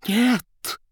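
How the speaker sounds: sudden high cry of terror; dreadful voice. Acted. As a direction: blurt it out, panicked and shrill. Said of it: "страшным голосом".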